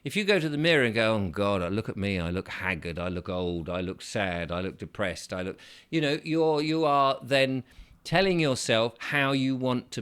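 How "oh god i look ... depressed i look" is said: low, soft, sagging voice; gloomy self-pity. Retold or imitated. Mocking gloomy mirror talk, low and mopey. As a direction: droop as if you feel old.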